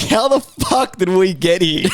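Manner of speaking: high, slow incredulous question; wigged out. Retold or imitated. Man retelling people-watching in the shop, wigged out. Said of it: "fucking wigged myself out".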